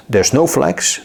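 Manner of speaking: normal talking voice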